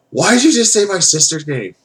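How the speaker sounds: hammed-up affronted woman, pitched high; mock indignant sister. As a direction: imitate an offended sister confronting someone. Voices the sister confronting him.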